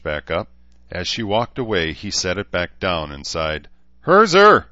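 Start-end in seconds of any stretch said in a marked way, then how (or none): 4.1-4.7 loud, high-pitched shout; calling out. A narrator's reading. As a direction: summon someone across a camp. Baron calling across the camp.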